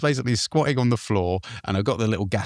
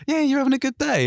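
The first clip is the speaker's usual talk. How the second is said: very high cheery officer; officer checking on tourists, high and cheery; patrol officer. Retold or imitated. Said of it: "he's just like".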